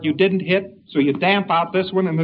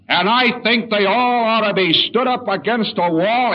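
raised, harsh, slowed rant; angry man; 5 semitones higher, 4 dB louder, slower than usual. Acted. Angry client ranting about the workers, raised and slow. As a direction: hammer each word like a man who wants them shot.

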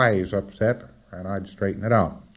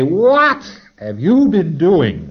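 slow probing auditor; 8 semitones higher, 9 dB louder, much slower than usual. Retold or imitated. Auditor question acted out.

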